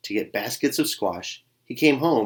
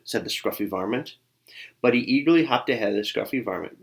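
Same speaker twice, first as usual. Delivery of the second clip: ordinary delivery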